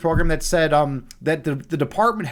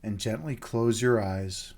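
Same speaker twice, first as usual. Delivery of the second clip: much lower, much softer, slow meditation voice; guided meditation; 9 semitones lower, 14 dB quieter, slower than usual. Acted. Host guiding viewers into meditation, soft and slow. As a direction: ease them down into stillness with long pauses.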